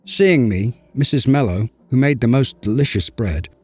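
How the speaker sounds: ordinary delivery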